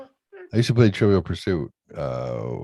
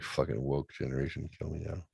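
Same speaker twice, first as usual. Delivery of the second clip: muttered aside; 3 semitones lower, 12 dB quieter than usual. Retold or imitated. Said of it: "I'm like".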